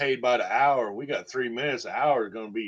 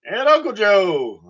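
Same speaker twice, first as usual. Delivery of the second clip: hammed-up announce; 8 semitones higher, 10 dB louder, slower than usual. Hamming up a nickname.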